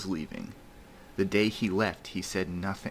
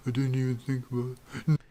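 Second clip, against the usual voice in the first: high, quick mock mumble; put-on high mumbling voice; imitated miserable mumble; 6 semitones higher, faster than usual. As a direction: mimic his miserable mumbling, pitched up and rushed. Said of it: "He miserably mumbled to me".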